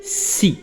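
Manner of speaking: "سي" said alone with a hissed s. Shown as a demonstration. Teacher showing the sound inside citron, isolated si. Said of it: "انا نطقتها هنايا بحال".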